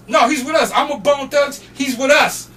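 high, loud retold insistence; insistent vouching. Roadie overruling security for the fan, insistent. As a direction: overrule the guard with full confidence.

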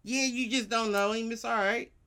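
ordinary talk

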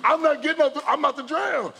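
high, loud desperate yelling at the lifeguard; panicked yell. Retold or imitated. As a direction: yell it like you're about to drown.

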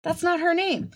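high, insistent mock correction; cousin correcting dad. Son corrects his father about her name, high mock voice. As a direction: imitate the son setting his dad straight. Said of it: "was like".

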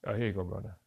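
light Hawaiian pidgin imitation of the rental guy; a hint of Hawaiian pidgin, easygoing. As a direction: Hawaiian pidgin, friendly clerk handing over keys. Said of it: "he was talking in deep pidgin".

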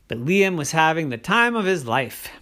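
ordinary delivery, nothing marked

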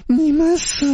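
very high, eerie ghostly cry; ghost threat. A narrator's reading. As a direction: hiss the curse from right behind him. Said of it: "女鬼的声音".

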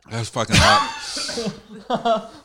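slow, strained laughing groan; mouth burning from spicy food. Real, not acted. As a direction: drag it out, mouth on fire. Eating very hot chilli.

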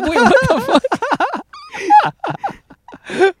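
high, loud startled cry; shocked disbelief. Retold or imitated. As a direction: squeal it in shock. Imagined onlooker reacting in shock.

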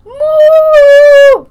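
loud high mooing cow. Pretend. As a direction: belt out the moo. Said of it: "give your best impression of a cow".

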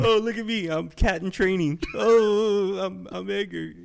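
high-pitched silly brag; mocking co-host impression. Teasing Edgar back as a show-off, high mocking voice.

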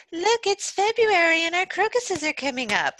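mocking smug BC local. [name] mocking BC people bragging about crocuses, high mocking voice. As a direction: sneer at a smug West Coaster bragging about spring. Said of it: "whenever they're like".

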